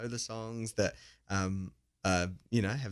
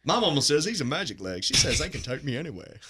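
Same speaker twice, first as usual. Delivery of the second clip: put-on Southern American accent, a bit higher; Forrest Gump impression; 4 semitones higher, 4 dB louder than usual. Guest answers the host's movie cue, Southern accent. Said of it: "Forrest Gump fan".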